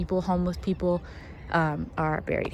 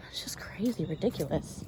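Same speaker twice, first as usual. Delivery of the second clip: lower, whispered ridiculous; disgusted whisper; 4 semitones lower, 7 dB quieter than usual. Real, not acted. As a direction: shake your head under your breath. Annoyed at witchcraft, whispered.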